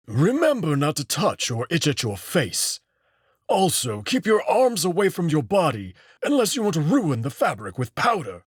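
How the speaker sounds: a fussy tailor's last warnings; fussy old wolf instructing. Acted. Old wolf gives final instructions.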